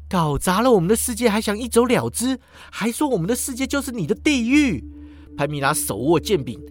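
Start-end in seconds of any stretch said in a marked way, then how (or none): 0.1-4.8 higher, indignant accusation. Acted. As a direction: throw it back at him. Pamela accuses.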